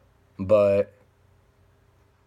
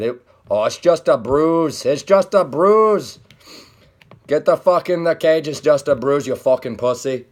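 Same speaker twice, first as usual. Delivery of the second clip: put-on loud brash Irish accent, pitched up; 6 semitones higher, 6 dB louder than usual. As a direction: bark it out like a cocky Irish fighter. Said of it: "that was my Conor McGregor impression".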